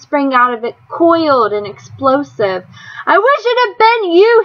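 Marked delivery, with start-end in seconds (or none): 3.1-4.4 high, loud wounding scream; screaming rage. A narrator's reading. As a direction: throw the words like a weapon. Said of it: "he screamed".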